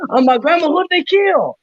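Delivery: high mock grandma shouting at her telenovela; grandma yelling at the TV. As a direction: squawk at the screen like an upset grandma. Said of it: "your grandma".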